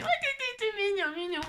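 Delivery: high squeaky coo at a cute bunny; baby talk coo. Pretend. Crafter cooing at her paper bunny, in high baby talk. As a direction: coo it like talking to a baby animal.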